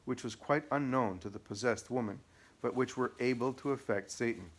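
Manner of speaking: ordinary delivery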